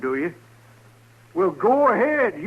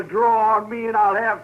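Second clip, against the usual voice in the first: ordinary delivery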